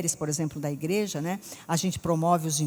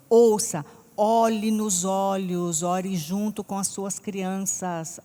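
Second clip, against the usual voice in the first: very slow, deliberate preacher's advice; slow emphatic exhortation; 4 dB louder, much slower than usual. Acted. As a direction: lay each word down for the parents. Exhorting parents slowly.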